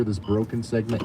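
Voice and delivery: ordinary talk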